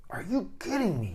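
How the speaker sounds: high-pitched mock disbelief; incredulous man. Man hearing wife's worries, incredulous. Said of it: "to a man, you're like".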